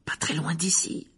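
secretive whispered hint. Acted. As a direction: whisper it conspiratorially. Seamus shares secret news. (chuchotement complice)

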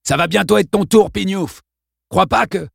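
menacing bully taunt. A narrator's reading. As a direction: sneer it like a teenage thug. Bully threatens the boy.

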